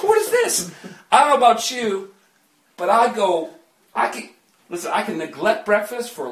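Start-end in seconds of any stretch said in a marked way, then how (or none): 0.0-0.7 much higher, laughing disbelief; baffled what. Retold or imitated. Preacher acting his own puzzlement to the crowd, high and laughing. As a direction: squeak it out, amused and confused. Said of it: "I'm like".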